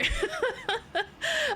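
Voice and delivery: squeaky high laugh burst; high-pitched laugh; very high giggle. Real, not acted. Laughs about moving.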